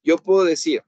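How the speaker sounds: ordinary talk, nothing marked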